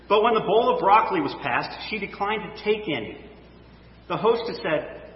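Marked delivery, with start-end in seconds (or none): none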